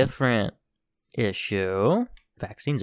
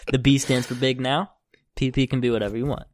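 ordinary talk, nothing marked

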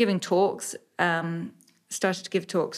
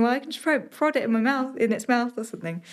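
1 high anxious thought; 7 semitones higher than usual. Retold or imitated. Retold frantic thought with knife.

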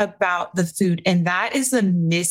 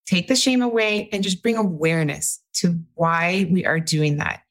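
ordinary delivery

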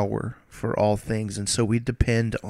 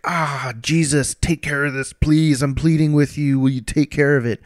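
higher, louder, begging plea to Jesus; pleading prayer; 4 semitones higher, 3 dB louder than usual. Retold or imitated. Paul begging Jesus a third time, higher and louder. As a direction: beg like a desperate man on his third try. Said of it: "he pleaded with the Lord three times".

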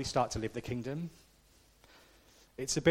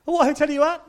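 much higher and louder, hammed-up holidaymaker; mock tourist voice; 14 semitones higher, 13 dB louder, faster than usual. Preacher mocking tourists on the coach, hammed-up high voice. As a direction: send up the chatty family on the coach.

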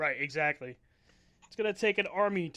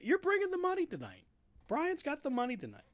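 excited; 9 semitones higher than usual. Real, not acted. Hyping Brian up.

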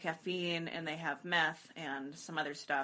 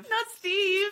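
high, loud laughing squeal of protest; laughing mock outrage; 14 semitones higher, 9 dB louder than usual. Friend echoing not Steve, laughing high mock protest. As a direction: shriek it in laughing mock horror.

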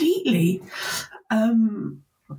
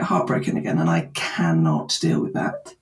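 hushed inner voice; 14 semitones lower than usual. Retold or imitated. Voicing her fearful inner thought.

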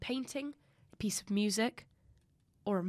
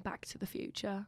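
whispering; 10 dB quieter than usual. Real, not acted. Whispered answer.

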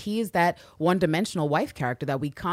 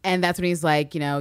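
ordinary talk

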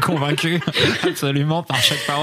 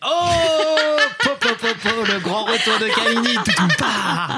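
laughing; 11 semitones higher, slower than usual. Real, not acted. Gleeful mock fanfare for return.

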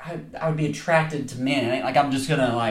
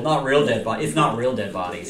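ordinary delivery

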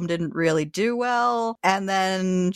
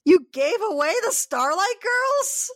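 aghast, can't believe it; outraged disbelief; 12 semitones higher than usual. Real, not acted.

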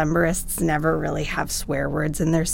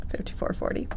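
whisper; 10 dB quieter than usual. Pretend. Whispered echo of title.

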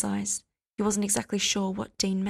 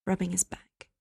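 usual speaking voice

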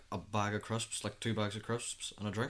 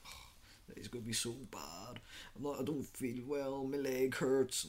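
imitating feeling unwell; 4 semitones higher, slower than usual. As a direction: put on a poorly, complaining whine, dragging out the end. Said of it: "I just feel very bad".

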